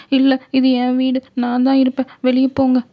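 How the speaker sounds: put-on high, loud frightened girl's cry; scared girl shouting. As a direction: yell at the intruder in panic, high and loud. Girl insists it's her house. (பயந்து கத்தும் சிறுமி)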